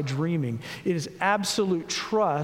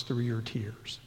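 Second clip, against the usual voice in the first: hushed; 8 semitones lower, 7 dB quieter, slower than usual. Acted. Describing reading through tears.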